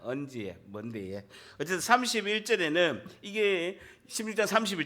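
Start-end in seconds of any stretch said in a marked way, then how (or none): 0.0-1.2 low, soft Pohang accent; tentative Pohang-accented question; Pohang dialect. Shown as a demonstration. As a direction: try a Pohang lilt quietly. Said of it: "그게 낫겠다".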